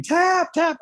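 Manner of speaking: high mock scream, held back in volume; retold screaming. Fighter re-enacting his panicked tap-out, mock screaming. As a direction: imitate frantic screamed pleas to stop. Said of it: "So I just start screaming".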